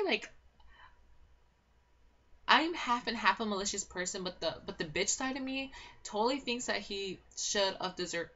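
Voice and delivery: usual speaking voice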